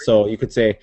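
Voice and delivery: normal talking voice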